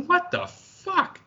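high, slow, drawn-out outrage; stunned disbelief. Real, not acted. Outrage at scouts lawsuits.

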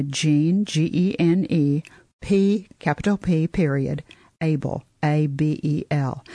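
slow, letter-by-letter spelling; dictating. Real, not acted. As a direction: spell each letter out clearly. Spelling out author name slowly.

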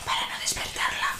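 hushed whisper; whispering over a crib. Acted. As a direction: whisper so the sleeping girl stays asleep. Girl asleep, don't wake her.

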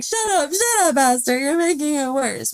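high, urgent mock shout; mock exasperated plea. Viewer yells at Esther to stop, high. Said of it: "I was like".

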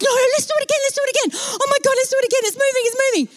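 very high, squealed urging; frantic excitement. Retold or imitated. Passenger to colleague as the elevator moves, high frantic excitement. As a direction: squeal it over and over in frantic hope.

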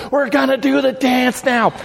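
high shouted announcement; mock yell. Friend shouts to the audience, high mock yell. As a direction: call it out high to the crowd. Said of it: "And he goes".

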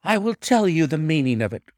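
a touch of proud defiance. A narrator's reading. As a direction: stand tall and answer proudly. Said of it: "cried the lady".

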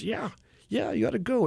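high, light voice for the wife, urging; mock wife voice. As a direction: toss it off high like she's waving him out. Wife quoted pushing him to go.

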